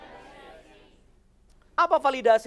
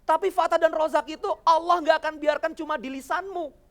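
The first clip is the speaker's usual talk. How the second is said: usual speaking voice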